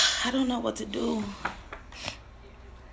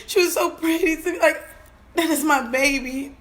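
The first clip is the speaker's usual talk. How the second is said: real tears breaking through the words; crying; 3 semitones higher than usual. Real, not acted. A mother crying about her daughter's beauty. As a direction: voice high and cracking as she weeps.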